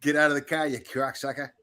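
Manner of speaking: hammed-up Boston tough guy; mock Boston accent. Shown as a demonstration. Host shows how to say it, hammed-up Boston accent. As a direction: curse like a Boston local, half in jest. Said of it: "can you say".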